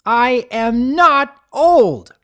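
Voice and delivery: slow, loud, high furious yell; furious roar. Acted. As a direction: bellow it at the jokers. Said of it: "Twilight roared".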